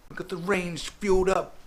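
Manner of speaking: high, loud hype-man bellow; rally the crew like a hype DJ; over-the-top DJ rallying call; hyped DJ shout. Retold or imitated. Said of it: "He's like".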